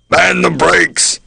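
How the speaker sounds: slow, drawn-out order through a trumpet; fire marshal orders. A narrator's reading. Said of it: "he'd say".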